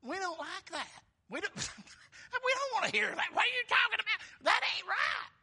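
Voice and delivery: high, rising protest; high indignant objection; protesting Peter. Retold or imitated. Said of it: "But Peter's like".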